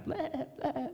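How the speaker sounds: imitated sheep bleating; two short mock bleats, like a sheep calling out. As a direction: do a sheep's "baa". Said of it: "it was bleating at them".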